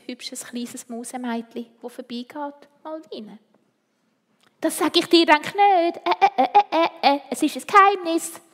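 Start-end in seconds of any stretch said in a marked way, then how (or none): 4.6-8.4 high, loud singsong tease; taunt, I won't tell; teasing. Acted. Malvine teases the other mouse girl, refusing to tell, high and loud.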